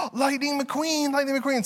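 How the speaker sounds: put-on high, slow, amazed little-kid voice; excited toddler. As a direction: gasp out the car's name like a thrilled toddler. Voicing toddler with toy car.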